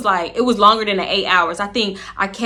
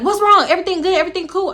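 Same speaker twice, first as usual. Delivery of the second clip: worried friend; 9 semitones higher, faster than usual. Retold or imitated. Friend outside the stall asking what happened, high and worried. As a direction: check on her through the stall door.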